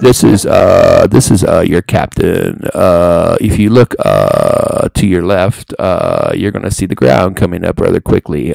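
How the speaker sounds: low, loud cockpit announcement voice; pilot voice. Pretend. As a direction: speak like the captain on the intercom. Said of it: "that's my, my pilot voice".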